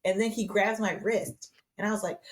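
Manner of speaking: ordinary delivery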